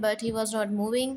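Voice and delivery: ordinary delivery, nothing marked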